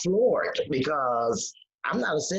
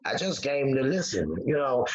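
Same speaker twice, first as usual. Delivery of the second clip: ordinary talk, nothing marked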